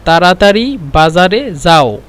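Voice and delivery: تراتري بازار زاو said slowly, each word apart; slow teaching demo. Shown as a demonstration. Teacher slowing the phrase for students, slow and clear. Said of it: "طيب".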